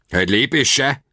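high-pitched sharp warning, narrated; warning cry. A narrator's reading. Prisoner halting the charging warriors, cried warning. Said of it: "kiáltottam".

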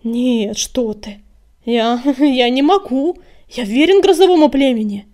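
stammering, panicky refusal; horrified. A narrator's reading. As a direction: back out fearfully, rising to a loud plea. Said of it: "В голосе его прозвучал неподдельный ужас".

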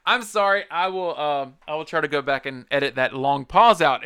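ordinary delivery

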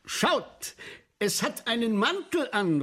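high excited cry; a count crying out in delight; calling out. Acted. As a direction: shout it out to the whole hall. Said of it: "ruft der Graf de Berny".

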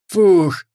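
loud, high "phew"; big exhaled "phew"; relieved puff. A narrator's reading. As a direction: let out relief after a narrow escape. Captain relieved after escape.

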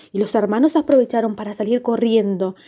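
ordinary delivery, nothing marked

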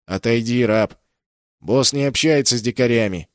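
loud, contemptuous bark at a slave; angry guard shout. Acted. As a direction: shout the prisoner down with scorn. Pirate guard shoves prisoner away.

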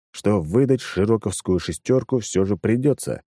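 ordinary delivery, nothing marked

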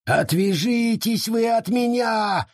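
high, slow angry yell; growled shout. Acted. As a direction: roar at them to go away. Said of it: "прорычал он".

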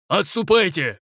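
high urgent shout. A narrator's reading. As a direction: call the retreat. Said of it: "крикнул нам Коул".

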